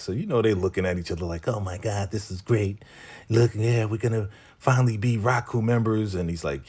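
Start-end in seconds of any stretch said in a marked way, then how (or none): 1.5-6.1 retold delight, slightly raised; mock excitement. Couple thrilled about joining, mildly excited. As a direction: play at being thrilled newcomers. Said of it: "they're super excited".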